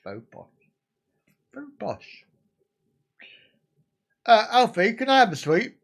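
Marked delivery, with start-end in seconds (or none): 0.0-0.5 mock posh. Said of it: "he thinks he's posh". 1.5-2.3 lightly hammed-up posh voice, much higher; a touch of a posh toff; mock posh. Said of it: "he thinks he's posh".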